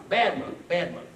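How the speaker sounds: loud, high mock chiding; acted cross reproach, punched on each phrase; angry scolding monk. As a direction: keep nagging him, hammed-up. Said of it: "is angry".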